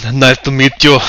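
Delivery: lightly put-on Terminator accent. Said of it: "kind of a Terminator-esque looking face".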